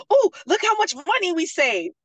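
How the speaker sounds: high, loud mock excitement; imitated delight. Husband showing off his budgeting savings, imitated delight. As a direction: crow over it like a thrilled husband. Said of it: "he is enjoying it".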